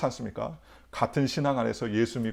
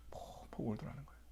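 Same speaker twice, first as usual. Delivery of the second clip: 펑펑 울더라는 거예요 fully whispered; 17 dB quieter than usual. Shown as a demonstration. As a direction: let the line fall to a breath. Repeats line in a whisper. (속삭임)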